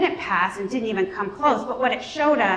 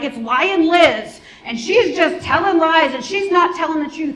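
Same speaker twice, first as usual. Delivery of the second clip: mocking opponents; 6 semitones higher, 5 dB louder than usual.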